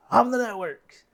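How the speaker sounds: high, loud proud shout. Acted. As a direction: yell it out triumphantly. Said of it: "And then the one says".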